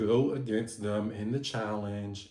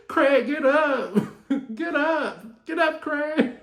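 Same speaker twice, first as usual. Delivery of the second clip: very high, loud mock yell through laughter; Regina King; 8 dB louder, slower than usual. Quoting the Friday scene, urging fallen Craig up, hammed-up yell. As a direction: cry out hammily, cracking up.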